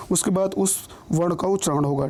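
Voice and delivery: ordinary talk, nothing marked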